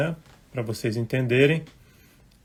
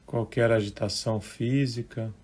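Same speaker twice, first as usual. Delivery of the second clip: unhurried, hushed relaxation guidance; guided meditation; 2 semitones lower, 8 dB quieter than usual. Real, not acted. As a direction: gently guide listeners into relaxing, phrase by phrase.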